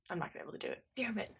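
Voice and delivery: near-silent breathy mutter; muttered aside. Real, not acted. Player muttering to himself about the accent. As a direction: say it under your breath, giving up. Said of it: "player mutters under breath".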